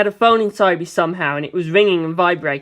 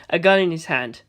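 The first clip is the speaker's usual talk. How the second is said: ordinary delivery, nothing marked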